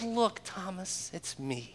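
gently calm a frightened friend; tender reassurance. Retold or imitated. Jesus gently reveals himself.

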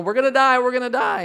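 high, loud terrified cry; panicked scream. Retold or imitated. Disciples screaming in the storm, high panicked shout. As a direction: scream it like sailors sure they'll drown. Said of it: "and they're screaming".

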